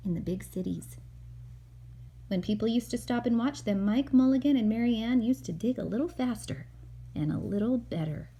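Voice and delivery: gentle storybook narration read aloud; calm read-aloud for a little boy; reading to a child. Acted. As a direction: read it like a bedtime picture book. Mother reads picture book aloud.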